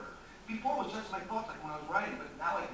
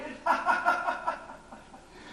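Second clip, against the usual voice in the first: nervous laugh; 9 semitones higher, 4 dB louder than usual. Acted. Laughs at his own word.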